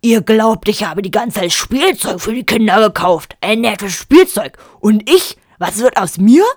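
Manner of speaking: loud, self-pitying complaint; aggrieved rant. A narrator's reading. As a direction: bluster like a wounded tenor. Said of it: "grollte Vater Löwenhaupt". (laut und gekränkt)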